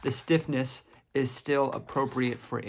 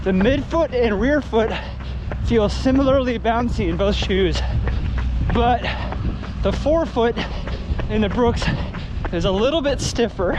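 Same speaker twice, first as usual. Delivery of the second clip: out of breath while running; 10 semitones higher, 8 dB louder than usual. Real, not acted. Talking while running the shoes.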